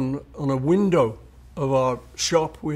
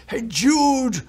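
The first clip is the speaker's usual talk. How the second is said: higher, louder indignant outburst; 10 semitones higher, 4 dB louder than usual. Retold or imitated. Angry caller quoting the song title back at him. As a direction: throw the title back in outrage. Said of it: "this furious phone call".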